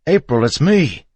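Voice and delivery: high, loud, desperate plea; husband shouting to be recognized. A narrator's reading. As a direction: make her hear that it's you. Pleading from behind tailgate.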